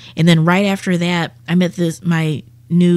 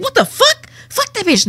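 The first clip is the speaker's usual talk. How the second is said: exploding in indignation at the news; outraged outburst. Retold or imitated. Dead man learns girlfriend cheated.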